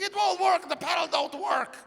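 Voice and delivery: very high mock wail; imitating a girlfriend crying out in frustration; tearful protest. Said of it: "And she's still crying".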